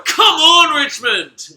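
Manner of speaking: high, loud cheer, dropping on the name; rousing yell. Real, not acted. Fan cheering Richmond after a goal, rousing yell. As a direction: roar your team on.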